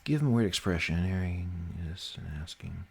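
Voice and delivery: usual speaking voice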